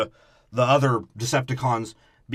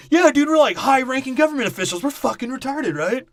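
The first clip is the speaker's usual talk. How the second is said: much higher, louder dumb-guy voice; mocking dopey officials; 10 semitones higher, 4 dB louder than usual. Host mocks the movie's officials, high dopey voice. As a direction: play them as clueless bros, pitched way up.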